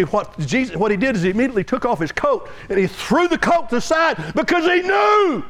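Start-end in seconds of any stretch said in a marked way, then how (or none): 2.7-5.4 preaching at full force. Real, not acted. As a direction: drive it home to the congregation. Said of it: "preaching at full force".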